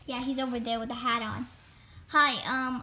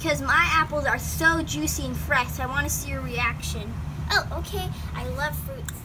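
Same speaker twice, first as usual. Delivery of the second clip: ordinary talk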